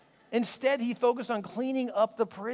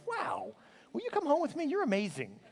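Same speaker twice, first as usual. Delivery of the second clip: mock delighted gush; 5 semitones higher, 5 dB quieter than usual. Delighted customer reaction.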